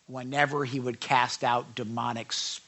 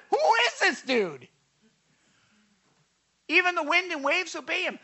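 much higher, loud and fast; astonished shout; 13 semitones higher, 4 dB louder than usual. Retold or imitated. The frightened disciples asking each other who Jesus is, loud and high. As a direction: blurt it out in awe. Disciples amazed after the storm.

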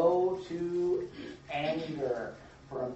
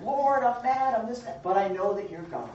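crying out to God; 6 semitones higher than usual. Retold or imitated. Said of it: "crying out to the Lord".

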